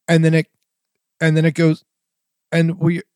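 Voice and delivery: broken off mid-phrase, with dead gaps; words cut short by silent dropouts; imitating mic cutting out. Shown as a demonstration. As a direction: mimic a microphone dropping out in chopped fragments. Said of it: "that was a pretty good representation of what was going on".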